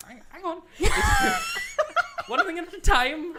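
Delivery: high, loud shout through laughter; cracking up while yelling to wait; laughing shout. Real, not acted.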